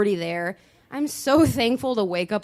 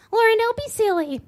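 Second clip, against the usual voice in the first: calming friend; 11 semitones higher, 5 dB louder than usual. Retold or imitated. Christine calming her, high voice.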